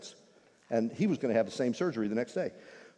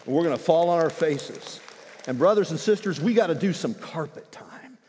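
louder, higher rallying call; fervent push toward prayer; rousing call; 5 semitones higher, 6 dB louder than usual. Real, not acted. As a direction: lift the room to action. Preacher rousing the church.

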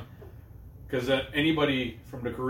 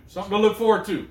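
hyped-up; 7 semitones higher, 5 dB louder than usual. Real, not acted.

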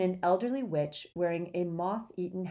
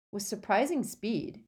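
plain reading voice

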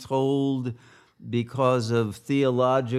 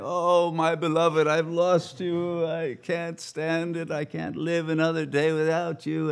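mock crying lament; 6 semitones higher than usual. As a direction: imitate a grieving lover's theatrical moan. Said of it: "he wanders around crying".

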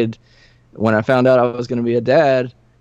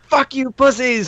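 high-pitched strained mock scream at a crowd; 12 semitones higher than usual. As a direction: imitate yourself screaming abuse at the audience. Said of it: "I'm, like, screaming at the audience".

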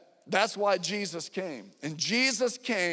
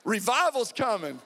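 excited proclamation; 5 semitones higher, 5 dB louder than usual. Real, not acted. Celebrating son arriving early.

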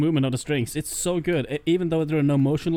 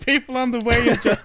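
ordinary talk, nothing marked

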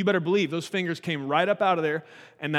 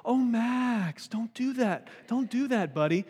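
mock calm gentle parent; 8 semitones higher, slower than usual. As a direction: coo at the child like a parenting magazine would. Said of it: "in a very calm, gentle voice".

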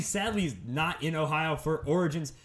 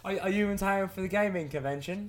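imitated British concierge, higher pitched; high-pitched mock British accent; 4 semitones higher than usual. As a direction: put on a British accent for the question. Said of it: "was totally British".

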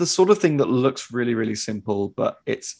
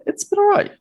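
much higher, louder squeak; squeaky falsetto; 6 dB louder, faster than usual. Acted. Squeaky first take before plain.

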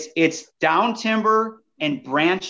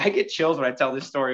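ordinary talk

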